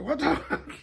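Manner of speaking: high startled curse through a laugh; shocked. Retold or imitated. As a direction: blurt it out in shocked disbelief, half laughing. She finds the stranger.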